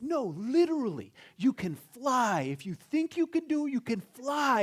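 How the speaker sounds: very high, loud zealous champion; high-pitched, loud post-game frenzy; over-hyped athlete. Acted. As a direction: insist wildly like a carried-away winner. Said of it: "they're interviewing a guy".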